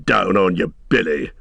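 understated stern wizard's command; contained, menacing rebuke; sudden terrible voice. A narrator's reading. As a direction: order him to grovel. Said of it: "in a sudden terrible voice".

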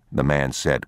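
ordinary delivery, nothing marked

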